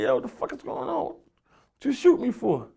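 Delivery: imitating his own panic; mock bewildered demand; retold disbelief. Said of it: "I'm like".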